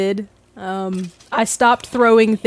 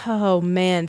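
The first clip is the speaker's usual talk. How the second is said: ordinary delivery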